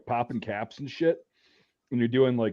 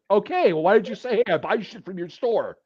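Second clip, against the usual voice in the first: higher-pitched, exasperated comeback; retold exasperation; 7 semitones higher than usual. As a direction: act out being fed up with him. Retells exasperated reply to accuser.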